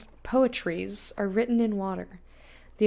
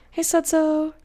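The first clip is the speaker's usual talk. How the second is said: high, loud bright hello; excited greeting; 6 semitones higher, 5 dB louder than usual. Real, not acted. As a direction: call out the name happily. Greeting a chat viewer.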